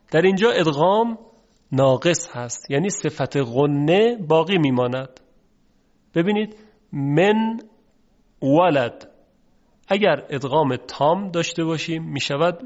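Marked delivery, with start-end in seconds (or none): none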